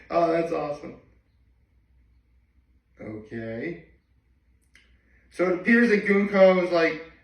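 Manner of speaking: usual speaking voice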